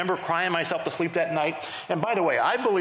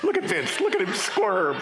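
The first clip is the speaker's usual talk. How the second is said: gleeful God; slower than usual. Retold or imitated. God showing angels a squirming man, mock glee. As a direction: relish the squirming, stretching the last words.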